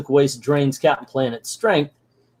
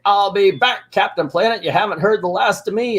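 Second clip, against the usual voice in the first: higher-pitched villain's parting threat; Piggly Wiggly; 6 semitones higher than usual. Retold or imitated. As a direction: vow revenge like a cartoon baddie. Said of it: "We see Piggly Wiggly here".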